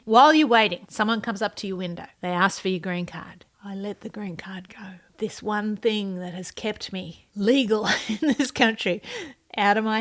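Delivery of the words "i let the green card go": hushed mock-dramatic whisper. Handing over the green card, dramatic whisper. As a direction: breathe it out like a secret loss.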